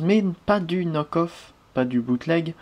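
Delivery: usual speaking voice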